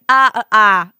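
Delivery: loud, open "ah" repeated; open AH vowel. Shown as a demonstration. Vocal coach isolating the vowel, open AH. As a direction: open the mouth on each "ah". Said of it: "it's a lovely ah vowel".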